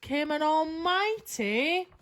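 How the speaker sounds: usual speaking voice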